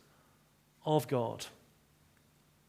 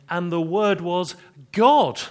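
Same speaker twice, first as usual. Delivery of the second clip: louder and higher, slow and emphatic; 4 semitones higher, 9 dB louder, slower than usual. Shown as a demonstration. To the congregation, stressing the true reading, loud and slow. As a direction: proclaim "And the Word was God." loudly, slowly, pitch raised. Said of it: "He's actually emphasizing".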